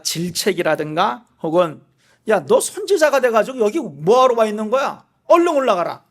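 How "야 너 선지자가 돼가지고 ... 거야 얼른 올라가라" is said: louder, higher scolding challenge; stern rebuke of a prophet who fled; rebuking demand. Pretend. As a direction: scold him for running away. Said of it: "하나님이 따져 묻는 그런 거기보다는".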